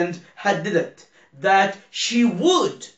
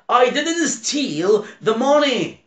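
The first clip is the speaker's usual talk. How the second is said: acted quote; 7 semitones higher, much slower than usual. Teacher acts out example quote.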